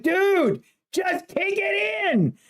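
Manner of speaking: high, strained cry of disbelief, drawn out; exasperated yell at players. Retold or imitated. Watching soccer, urging the player to shoot, high exasperated cry. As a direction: plead at the screen in a high voice.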